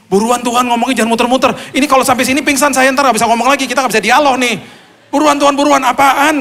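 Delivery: high, loud, hurried plea to God; desperate urgent shout. Retold or imitated. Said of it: "Terus saya ngomong gini". (berteriak mendesak Tuhan, tinggi dan keras)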